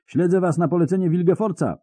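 roaring confession, high and loud; bellowing. Acted. Spy Riens roaring at his captors, bellowing. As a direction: roar it out in panic. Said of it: "zaryczał Riens".

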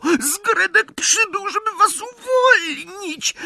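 very high, slow squeaky elf; Zgredek. A narrator's reading. House-elf announces the rescue, thin high squeak. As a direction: squeak slowly in a tiny elf voice. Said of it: "zaskrzeczał cichutko".

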